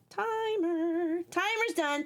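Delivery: high, drawn-out timer announcement; high call-out. Real, not acted. Putting her stream timer on, high call-out.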